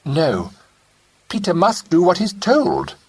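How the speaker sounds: a mild firm mother insisting; firmly. Acted. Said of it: "said Betty firmly".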